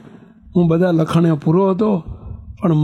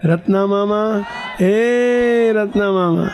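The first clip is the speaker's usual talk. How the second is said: drawn-out calling; 3 semitones higher, much slower than usual. Shown as a demonstration. Nephew calling his uncle from outside, long slow call. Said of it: "શું બૂમ પાડતો તો કહો છો?".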